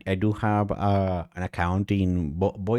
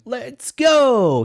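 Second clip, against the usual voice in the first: anime battle cry; 13 semitones higher, 5 dB louder than usual. Retold or imitated. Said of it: "he went straight for that".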